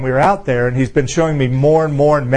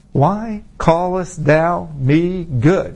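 very slow quoted question; measured rebuke; much slower than usual. Retold or imitated. Jesus questioning the ruler's salute, measured rebuke. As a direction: draw out each word pointedly.

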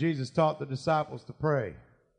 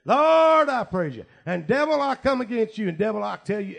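booming preacher prayer, shouted at the start; shouting Lord in prayer; loud prayer; 4 semitones higher, 6 dB louder than usual. Retold or imitated. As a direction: call out to the Lord at full voice. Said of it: "I prayed loud back then".

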